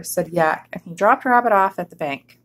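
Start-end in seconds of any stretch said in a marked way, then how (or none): none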